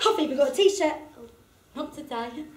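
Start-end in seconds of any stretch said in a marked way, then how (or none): none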